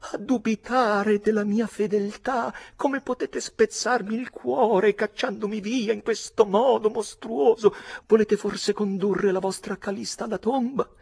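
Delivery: high, pleading, impassioned woman's voice; passionate widow. A narrator's reading. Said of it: "gli rivolgeva parole vibranti di passione". (vedova appassionata, voce acuta e supplichevole)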